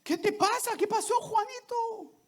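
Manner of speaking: a father gently asking his frightened son; worried father. Retold or imitated. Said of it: "le dice".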